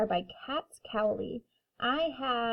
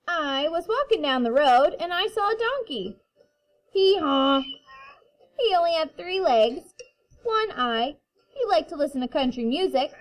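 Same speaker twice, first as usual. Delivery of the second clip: put-on reading to children; 4 semitones higher, 5 dB louder than usual. A narrator's reading. Reading picture book to kindergarten.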